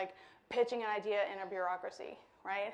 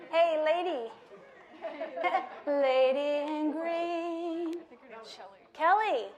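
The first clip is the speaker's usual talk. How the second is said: louder, higher call across the room; 4 semitones higher, 6 dB louder, much slower than usual. Real, not acted. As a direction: call her out playfully. Calling out to a listener.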